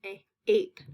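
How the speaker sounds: a-, ape with the vowel held apart; isolated long A. Shown as a demonstration. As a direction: sound out the ending a-, ape. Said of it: "You can hear that long A".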